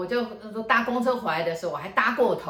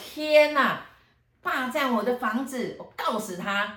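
high, loud burst of disbelief, then slower threat; shocked outrage; 6 semitones higher, 4 dB louder, slower than usual. Retold or imitated. Owner, stunned to hear his house was occupied, outraged. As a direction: retell the owner's indignant outburst.

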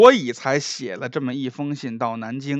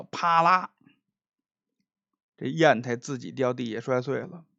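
ordinary delivery

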